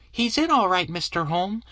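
put-on high, eager young street boy; a ragged lad crying out a report to Holmes; street urchin calling out. Street boy to Holmes, high eager call.

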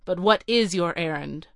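a haughty butler reeling off a list of refusals; curt butler. Acted. Butler answers the glass blower.

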